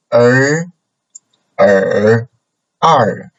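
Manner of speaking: er said with changing tones, mouth wider on the last; tone drill on er. Shown as a demonstration. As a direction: show the tone shift on er. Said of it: "open your mouth a little bit wider".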